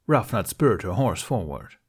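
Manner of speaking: ordinary delivery, nothing marked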